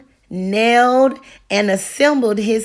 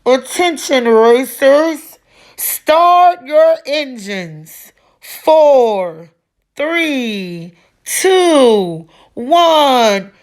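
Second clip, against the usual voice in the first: loud, drawn-out race announcer countdown; big boomy announcer; 4 semitones higher, 4 dB louder, much slower than usual. A narrator's reading. The announcer starts the race for the racers, loud and boomy. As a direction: boom it over the crowd. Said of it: "announcer's big boomy voice called out".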